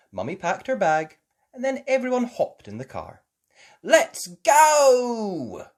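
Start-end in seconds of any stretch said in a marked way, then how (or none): 3.8-5.7 high, loud, held-out shout; excited cheer. Acted. Child setting off in the car, cheering. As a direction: cheer it with a long final vowel. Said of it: "cheered Peppa".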